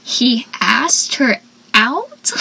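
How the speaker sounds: slow, drawn-out rising question; hammed-up surprise; mock disbelief. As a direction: act stunned. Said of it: "Joey was just like".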